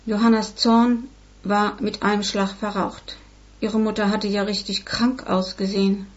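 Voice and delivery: ordinary delivery, nothing marked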